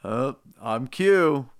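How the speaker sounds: slow, lightly put-on silly voice; random put-on voice. Friend drawls a silly voice to the cohost. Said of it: "your impression of what my voice sounds like".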